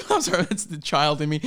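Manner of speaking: normal talking voice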